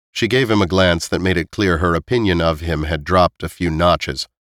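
usual speaking voice

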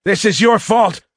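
high, loud, frantic cadet; frantic blame. A narrator's reading. Cadet squeezes the trigger at the warrior, frantic.

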